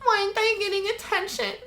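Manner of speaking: high, small attention-seeking voice; mock needy whine. Mimics own needy whining.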